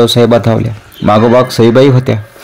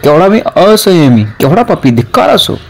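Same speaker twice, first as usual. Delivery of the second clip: mild angry outburst, pitched higher; a touch of anger at himself, raised pitch; understated bitter exclamation; angry self-reproach; 7 semitones higher than usual. A narrator's reading. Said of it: "रागाने आंबा भिरकावून देत पंत उद्गारले".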